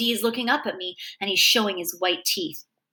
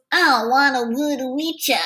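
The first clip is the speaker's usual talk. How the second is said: dumb slurred talk; 3 semitones higher than usual. A narrator's reading. Bully stops the kids for a word, dumb slurred talk. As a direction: run the words together like a dim thug. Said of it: "the dumb way he talks".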